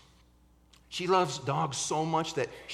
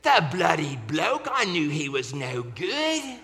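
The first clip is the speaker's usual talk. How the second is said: put-on British accent; 5 dB louder than usual. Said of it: "yapped into there".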